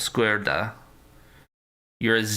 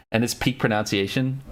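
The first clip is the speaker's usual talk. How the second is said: normal talking voice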